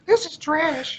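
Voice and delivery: high-pitched mock bratty complaint; hammed-up kid voice; whining kid. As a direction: wail to mom like a let-down child. Acting child whining to mom.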